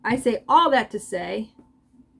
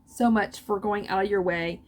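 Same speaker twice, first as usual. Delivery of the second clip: ordinary talk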